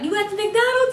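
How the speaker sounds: high, thin-voiced mock question; imitating light voice. Boy asking friend on the phone, imitated light voice. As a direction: mimic him well above your own pitch. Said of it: "his voice sounded light as fuck".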